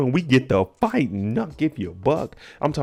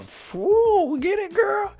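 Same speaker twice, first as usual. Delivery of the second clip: Uncle Clifford impression; much slower than usual. Recapper imitating Uncle Clifford hyping a dancer, high falsetto squeal. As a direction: shriek it like a hyped-up club host. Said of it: "Uncle Clifford done came out with a blow dryer talking about".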